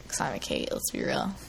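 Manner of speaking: whispered confession; whispered aside. Real, not acted. Confiding joke.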